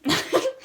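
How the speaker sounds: short laugh. Real, not acted. Listener reacts to reading.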